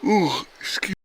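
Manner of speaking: talking through a big yawn. Real, not acted. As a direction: yawn out the words. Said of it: "Big yawn".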